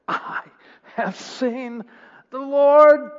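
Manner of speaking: high, halting, building to a loud cry; exultant announcement. Acted. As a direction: proclaim it word by word, rising to a shout. Mary announces the news triumphantly.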